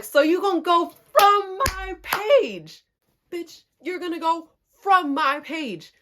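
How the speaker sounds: high, loud, drawn-out retold outrage; outraged disbelief. Confronting the cousin about her comment, outraged. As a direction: lean hard and slow on the accusation.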